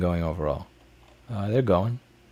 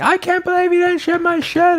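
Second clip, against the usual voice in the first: much higher mock whine of an outraged buyer; angry customer; 4 dB louder than usual. Streamer mocking a complaining customer, high mock whine. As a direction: parody a customer griping, high and put-on. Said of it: "all pissed off saying".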